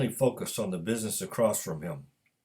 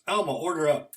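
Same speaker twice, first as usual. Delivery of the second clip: louder call with a lifted pitch; 6 semitones higher, 5 dB louder than usual. A narrator's reading. Cook calls the waitress from the kitchen, raised call. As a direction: call the order across the kitchen. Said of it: "called Thomas from the kitchen".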